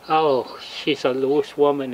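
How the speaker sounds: ordinary talk, nothing marked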